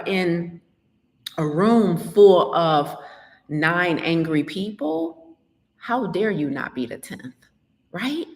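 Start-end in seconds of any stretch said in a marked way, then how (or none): none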